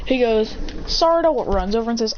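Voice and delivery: ordinary delivery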